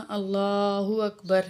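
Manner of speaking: slow, drawn-out call of Allahu Akbar; takbir recited. Shown as a demonstration. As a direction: intone it slowly. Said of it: "ہم جو ہے تکبیر کہیں گے".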